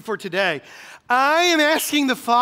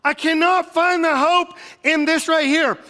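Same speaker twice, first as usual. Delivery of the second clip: higher and louder, mock alarm at no hope; mock panic; 6 semitones higher, 4 dB louder than usual. The optimist in him protesting, loud and alarmed. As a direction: play up the panic of finding no hope. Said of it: "I'm like".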